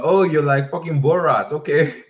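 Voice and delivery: high, loud joking exclamation; loud mock amazement. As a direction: bust out teasing her like a fan. Joking reaction to Kazakhstan.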